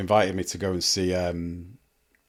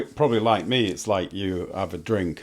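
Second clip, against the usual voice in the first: usual speaking voice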